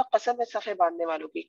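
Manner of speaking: usual speaking voice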